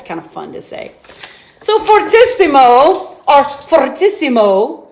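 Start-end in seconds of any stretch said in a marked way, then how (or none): 1.6-4.9 "fortissimo" loud with a rolled R. Shown as a demonstration. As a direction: roll the R in "fortissimo" real good. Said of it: "if you roll your R's real good".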